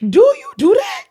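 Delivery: playful scandalized do you do that; mock shocked question. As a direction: gasp it out like you caught her. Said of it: "mock accusing question to friend".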